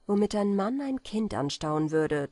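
plain reading voice

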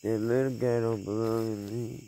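usual speaking voice

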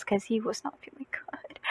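dropped to a whisper; whispered aside. Real, not acted. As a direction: whisper it like a confidence. Whispered aside about sick nephew.

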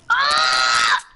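short high startled cry; startled yelp. Real, not acted. Gamer caught by an enemy mid-fight, startled yelp. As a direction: yelp as you get caught off guard. Sudden scare in the game.

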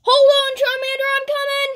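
high heroic holler; urgent high-pitched rescue call. Acted. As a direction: shout that you're on the way. Rescuer calls out to friend.

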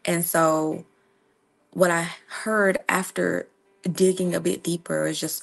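normal talking voice

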